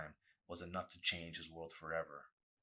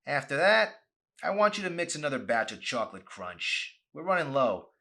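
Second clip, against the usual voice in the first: higher, loud overbearing boss voice; bossy manager; 8 semitones higher, 13 dB louder than usual. Acted. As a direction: order the kid around like a petty tyrant. Boss Ray barking orders.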